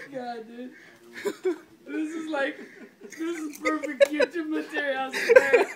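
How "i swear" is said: laughing. Real, not acted. Laughing at prank.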